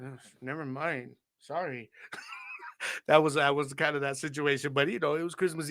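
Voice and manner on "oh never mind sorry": put-on soft, slow, deflated kid; sheepish backing down. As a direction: let it drop quietly and slowly like a kid giving up. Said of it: "And you're like".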